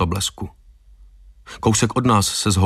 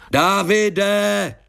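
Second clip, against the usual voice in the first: high, drawn-out call of a name; shout toward house; 11 semitones higher than usual. A narrator's reading. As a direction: yell it high as if across a distance. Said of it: "zakřičel jsem k domu". (protáhlé volání vysokým hlasem)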